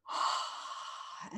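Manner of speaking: quiet unvoiced sigh out; just breathe it all out softly; soft breathy sigh. Shown as a demonstration. Guide exhaling with the listener, soft quiet sigh. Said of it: "comfortable breath".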